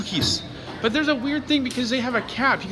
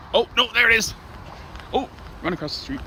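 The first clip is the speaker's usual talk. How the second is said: ordinary talk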